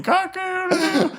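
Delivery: high mock cock-a-doodle-doo; a player doing a rooster call for laughs; rooster crow. Imitating a rooster crowing.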